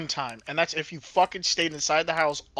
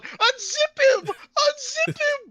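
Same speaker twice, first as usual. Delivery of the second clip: put-on very high, slow child yell; much slower than usual. Imitating the game child's yell at friend. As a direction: screech it like a small child. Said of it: "I heard the yell child".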